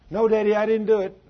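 put-on high, loud whiny child denial; pleading little boy. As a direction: cry out your innocence like a scared kid. Son denying to father.

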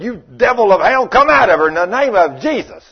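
loud rebuke; Paul casting out the devil, full voice; commanding shout. Retold or imitated. Paul rebuking the fortune-teller's spirit, commanding shout. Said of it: "He turned to that woman and said".

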